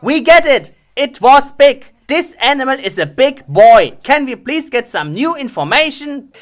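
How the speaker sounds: loud fed-up yell, pitch up; exasperated shouting. Real, not acted. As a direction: shout it like you've had enough. Exasperated at the repeated "it was big.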